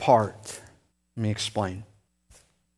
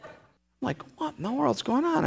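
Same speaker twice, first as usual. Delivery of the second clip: fast, much higher voice of mounting confusion; frantic disbelief; 13 semitones higher, faster than usual. Retold or imitated. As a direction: rattle it off high and panicked. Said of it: "was like".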